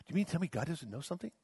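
normal talking voice

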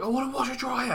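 mock old man repeating himself; stubborn old customer. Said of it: "He was like".

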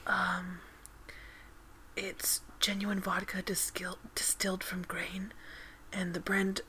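breathy whispered ASMR read. Acted. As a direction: whisper softly into the mic. ASMR Corner whisper segment.

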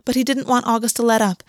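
ordinary delivery

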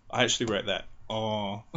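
usual speaking voice

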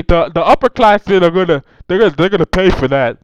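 very loud impression of a politician; Bernie Sanders accent. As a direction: bellow it out in a put-on accent. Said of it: "Let me try to do my best accent".

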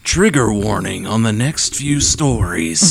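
exaggerated Canadian accent; lightly hammed-up Canadian accent. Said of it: "in a big Canadian accent".

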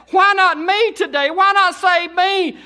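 slow, loud, high desperate shout; hollering sinner. Retold or imitated. Repentant sinner at the altar, hollering. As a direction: holler for mercy. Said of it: "weeping, hollering, crying".

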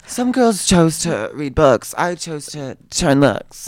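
hammed-up Aquaria impression, drawn out. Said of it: "that was better".

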